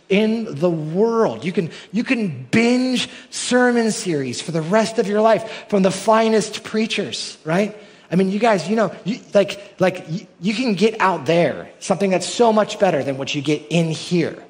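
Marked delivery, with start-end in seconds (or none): 0.1-10.7 higher, louder and slower, building; climbing preacher's excitement. Real, not acted. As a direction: preach it up with rising fervor. Preaching climax on online sermons.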